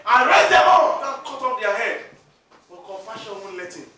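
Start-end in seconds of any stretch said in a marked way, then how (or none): 0.1-2.1 loud, slow shouted order; commanding shout. Acted. Joseph ordering his brothers punished, loud slow shout. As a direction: bellow the order for execution.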